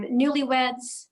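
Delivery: ordinary talk, nothing marked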